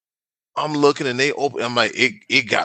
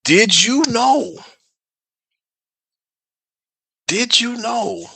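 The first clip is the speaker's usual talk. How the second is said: higher, slow dramatic teaser; teasing buildup; 8 semitones higher, much slower than usual. Real, not acted. Co-host building suspense for a new topic, slow and teasing. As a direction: draw it out before the big reveal. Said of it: "he's clapped his hands".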